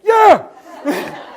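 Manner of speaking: huge high-pitched cheer; triumphant shout. Retold or imitated. Vet relives leaving the room after dog walked, triumphant shout. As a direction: let out a re-enacted victory yell.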